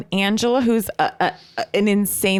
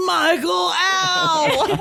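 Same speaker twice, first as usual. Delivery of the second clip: mock cry of pain; 8 semitones higher, much slower than usual. Wife struck by bag in car, yelping. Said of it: "And she goes".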